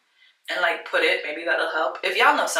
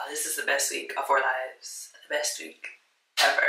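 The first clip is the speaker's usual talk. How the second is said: much softer, near whisper; hushed; 4 semitones lower, 8 dB quieter than usual. Real, not acted. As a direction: breathe the words to yourself. Quiet then loud repeat.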